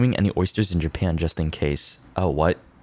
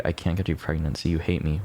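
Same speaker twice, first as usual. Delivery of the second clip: ordinary talk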